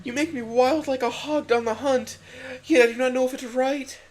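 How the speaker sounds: very high, concerned female voice; hesitant, worried Miranda. A narrator's reading. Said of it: "with concern".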